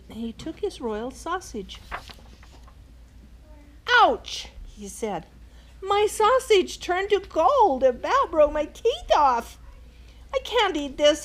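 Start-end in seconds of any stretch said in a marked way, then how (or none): 4.0-4.5 mock yelp. King bites golden sausage. 5.8-11.2 loud, high complaint; upset king. Acted. King at breakfast, loud high complaint about sausage.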